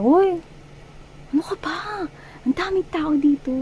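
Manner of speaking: high, loud playful scold; teasing partner, caught off guard in a crowd, high playful scold; mock flustered scolding. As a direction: squeal it like a shocked, flirty partner in public. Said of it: "nakakagulat na parang".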